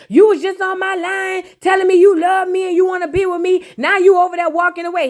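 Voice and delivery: Shay shouting as he walks away, hammed up; mock shouting Shay. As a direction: yell it at him like a hurt girlfriend. Shay yelling at Fabo leaving.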